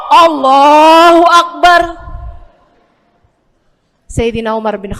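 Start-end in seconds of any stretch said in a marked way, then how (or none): 0.1-1.8 slow, loud, ringing Allahu Akbar; shouted takbir. Acted. Umar startled into ruku, loud slow takbir. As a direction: cry out the takbir slowly.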